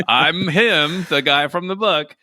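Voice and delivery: a lightly voiced impostor pretending; high mock impostor voice.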